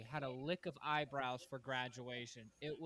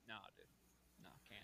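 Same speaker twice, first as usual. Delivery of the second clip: low, much softer laid-back brush-off; cool dismissal; 9 semitones lower, 23 dB quieter, faster than usual. Retold or imitated. Day-room boss turning away helpers, low soft cool. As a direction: wave them off, club-owner cool. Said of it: "I'm like".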